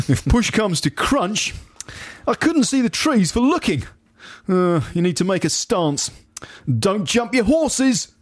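high, put-on colleague voice; blustering office chap. Reading a list of office malapropisms, high character voice. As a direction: deliver each mangled saying as the chap. Acts out office chap's phrases.

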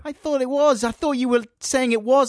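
high, protesting complaint; mock indignant. Co-host told it's not political phone-in, mock indignant. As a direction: protest at a high pitch as if badly let down.

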